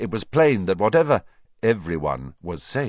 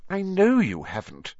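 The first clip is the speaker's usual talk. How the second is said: high, exasperated but admiring; half exasperated, half admiring; 11 semitones higher than usual. A narrator's reading. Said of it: "sounding half exasperated, half admiring".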